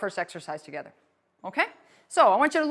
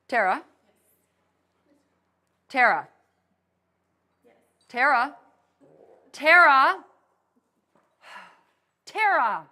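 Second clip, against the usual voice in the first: insistent repeated calling of a name; calling a name repeatedly; 4 dB louder than usual. Shown as a demonstration. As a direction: keep calling her, building up. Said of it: "you're saying my name over and over".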